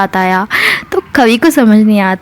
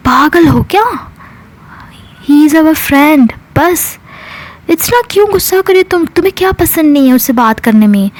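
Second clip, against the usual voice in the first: higher-pitched, incredulous pushback; acted exasperation; 6 semitones higher than usual. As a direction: argue back as if the accusation is crazy. Acted exasperated defence in quarrel. (झुंझलाहट भरा जवाब)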